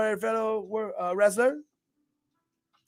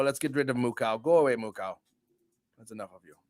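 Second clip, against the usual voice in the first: lower, fading voice; deadpan dismissal; low mock dismissal; 10 semitones lower, 5 dB quieter, faster than usual. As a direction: shoo the cow away under your breath. Dismissing moo cow.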